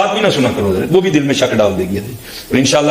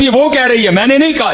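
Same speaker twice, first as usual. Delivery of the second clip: delighted higher repeat; 10 semitones higher than usual. Acted.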